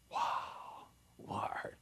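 whispered wonder to God; awed whisper. Shown as a demonstration. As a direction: breathe it out in awe. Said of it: "worshipful response".

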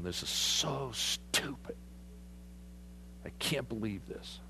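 quiet, slow, disgusted grumble; grumbling Naaman. Retold or imitated. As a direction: mutter it while shaking your head. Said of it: "shaking his head going".

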